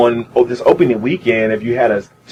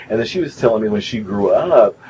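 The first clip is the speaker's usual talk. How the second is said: ordinary talk, nothing marked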